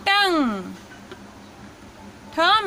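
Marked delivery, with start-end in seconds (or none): 0.1-0.7 टंग said clearly with the nasal ng; teaching demo. Shown as a demonstration. Teacher showing students how to say टंग. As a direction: model टंग for learners. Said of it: "तो ये हो जाएगा".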